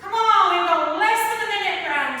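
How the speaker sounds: shouted encouragement; loud coaching. Real, not acted. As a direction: push them on, loud. Coach urging class mid-set.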